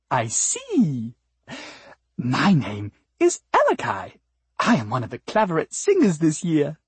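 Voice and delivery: lilting, swooping character voice; sweet flower singer. Acted. Flower singer introducing herself to the cat, sweet and lilting. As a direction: introduce yourself with sugary, sing-song poise.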